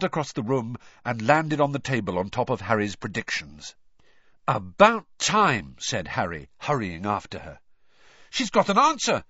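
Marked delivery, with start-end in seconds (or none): 4.5-5.8 loud, slow, drawn-out words; emphatic relief. A narrator's reading. Harry greeting his long-awaited owl, emphatic. 8.3-9.2 high, loud, excited exclamation. A narrator's reading. Ron spotting the note on the owl, excitedly. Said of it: "said Ron excitedly".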